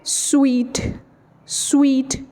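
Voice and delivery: स्वीट said like sweet. Shown as a demonstration. Teacher giving the right sweet pronunciation. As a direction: say स्वीट as sweet, not suit. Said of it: "नहीं पता होता".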